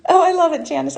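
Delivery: high, loud burst of delight. Real, not acted. Host reacts to a viewer's comment, delighted and loud. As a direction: squeal it out with joy.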